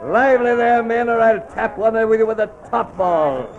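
high, hurrying deck call; calling to crew. Acted. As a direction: chivvy the men along from across the deck.

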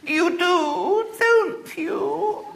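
put-on high, pleading, trembling girl's voice; quivering-lipped girl. A narrator's reading. Little girl persists with William, lips quivering. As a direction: press him through a quivering lip.

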